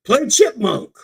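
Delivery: high, loud shout; yelled command. Retold or imitated. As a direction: bellow it as a warning. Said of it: "Maybe they yelled out".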